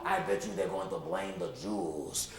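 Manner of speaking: lightly voiced old shopkeeper; worried Moish. Shopkeeper Moish fretting to the kids, low and grave.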